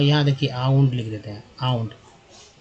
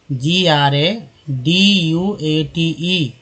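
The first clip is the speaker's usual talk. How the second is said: very slow letter-by-letter spelling; much slower than usual. Shown as a demonstration. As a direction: spell it out slowly while writing it. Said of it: "मैं यहाँ spelling लिखता हूँ". (धीरे-धीरे एक-एक अक्षर बोलते हुए)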